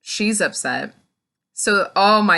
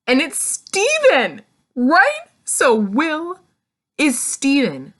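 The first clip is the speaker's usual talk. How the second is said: excited reveal; 8 semitones higher, 5 dB louder, slower than usual. Real, not acted. Revealing the big twist.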